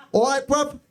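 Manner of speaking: high, chirpy "All right, bruv." with bruv clipped; young lad slang voice. Retold or imitated. Comic dad imitating the daughter's boyfriend saying "All right, bruv.". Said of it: "He did".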